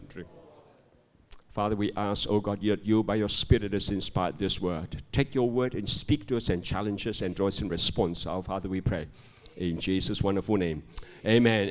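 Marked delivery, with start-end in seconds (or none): 1.5-11.7 low-pitched, earnest public prayer. Real, not acted. Pastor praying before the congregation takes seats, low prayer voice. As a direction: pray on behalf of the room, pitched down.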